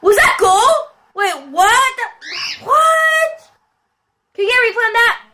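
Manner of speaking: high-pitched, baffled questioning; incredulous. Real, not acted. Player demands a replay of doubtful goal, incredulous high voice.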